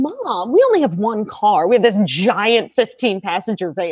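usual speaking voice

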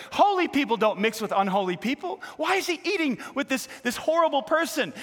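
high, loud mock outrage of the critics; indignant critics. Pastor mimics the critics, loud indignant outrage. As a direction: ham up the scandalized religious critics. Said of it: "they began to mutter".

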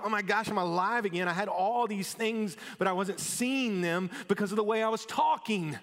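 excited realisation; amazed joy. Pretend. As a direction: wake up thrilled to be alive. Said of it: "you're like".

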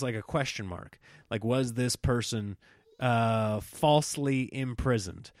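normal talking voice

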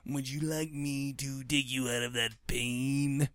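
low, slow, oily temptation; sinister genie voice. Retold or imitated. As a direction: coax him like a creepy djinn. Little djinn tempting the lab worker.

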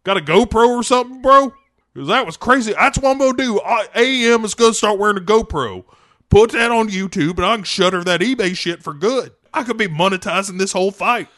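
high, fast hyped bro; a bro bragging breathlessly; dude bro voice. Acted. Aquaman voiced, continuing riff.

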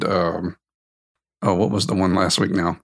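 ordinary delivery

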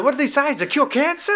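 high, incredulous questioning; mock rant. Film dad questioning the expensive sides, mock ranting. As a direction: imitate an outraged dad, voice shooting up. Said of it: "it's his fucking rant".

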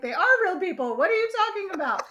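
high, loud, fast laughing protest; Kathy protesting they are real, laughing; indignant. Retold or imitated. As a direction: laugh it out in outrage. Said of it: "And Kathy's like".

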